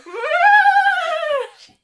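high, loud whinnying horse noise. Retold or imitated. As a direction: neigh like a horse at full pitch. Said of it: "I couldn't stop making that horse noise".

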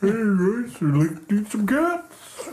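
high, goofy puppet voice; Alf voice. Retold or imitated. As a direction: ham up a cartoon alien puppet. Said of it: "the Elf voice".

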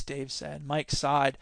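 ordinary delivery, nothing marked

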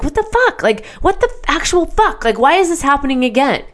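high, squeaky disbelieving rant; exasperated outburst. Retold or imitated. Awake on Sunday night, high exasperated outburst. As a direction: throw up your hands, voice shooting up.

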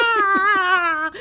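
high cackling witch cry; mock witch whoop. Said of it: "They're just going".

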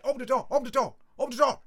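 high, loud, rapid repeated demand; urgent shouting. Retold or imitated. Lads demanding to get on the bus, urgent shouting. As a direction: shout it as an impatient mob at a door.

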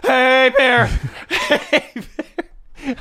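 high, panicky mock shout with laughs; terrified yell. Imitating terrified contestant facing bear, high panicky yell. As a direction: squeal it like a scared camper. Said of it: "someone terrified going".

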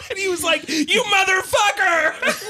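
high, slow, laugh-strangled mock shout; laughing through the line. Real, not acted. Retelling the friend's reply while cracking up, high and slow. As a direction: squeal it out mid-laugh.

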